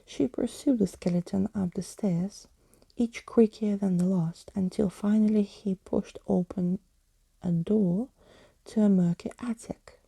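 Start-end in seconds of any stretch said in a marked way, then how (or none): none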